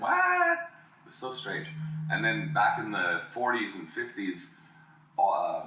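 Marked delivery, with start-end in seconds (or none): 0.0-0.6 squeaky shocked what, pitched way up. Retold or imitated. Kid hearing real Americans, high startled what. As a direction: blurt it out in disbelief. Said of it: "I was like".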